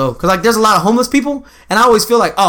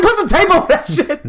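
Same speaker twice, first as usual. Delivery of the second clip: laughing mock outrage; 12 semitones higher than usual. Retold rant to the clerk.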